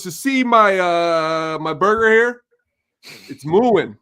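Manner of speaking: loud mock complaint; complaining customer. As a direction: act out a customer making a big complaint. Acting out joking customer's complaint.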